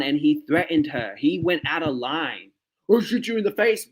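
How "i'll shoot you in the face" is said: high, hammed-up threat in a cop's voice; mock cop threat. Quoting the cop's threat to the woman, high mock voice. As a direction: throw the cop's threat back, much higher.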